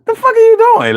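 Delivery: high squeaky laughing voice. Retold or imitated. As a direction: squeak the question through a laugh. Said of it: "With the squeaky voice".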